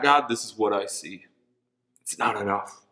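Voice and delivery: a touch of disheartened prayer; quiet lament. Retold or imitated. Acted prayer of lack.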